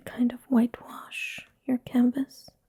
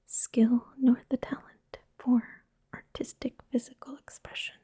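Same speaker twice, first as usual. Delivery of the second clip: ordinary talk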